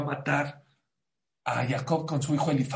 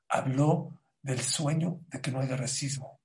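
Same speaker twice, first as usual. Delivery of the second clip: ordinary delivery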